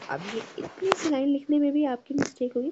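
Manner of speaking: normal talking voice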